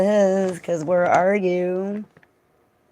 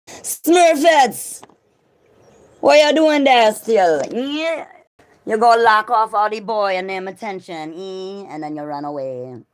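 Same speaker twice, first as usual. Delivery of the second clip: put-on Jamaican accent; 4 semitones higher than usual. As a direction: yell at them in patois. Jamaican scolding voice.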